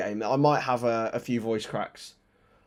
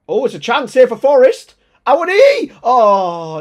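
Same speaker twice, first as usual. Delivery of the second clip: much higher and louder, yelling the chance; excited commentary shout; 13 semitones higher, 17 dB louder than usual. Real, not acted. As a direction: scream the player's name as he shoots. Big chance in the match.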